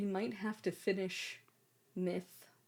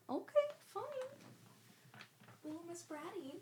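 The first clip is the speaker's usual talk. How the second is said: teasing; 10 semitones higher than usual. Real, not acted. Teasing the cat.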